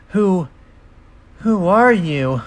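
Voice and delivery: high, halting, slow question; falter, then demand it loudly; alarmed. Acted. Spyro backing away from cloaked figure, alarmed.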